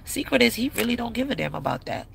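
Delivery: ordinary delivery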